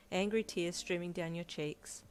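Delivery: plain reading voice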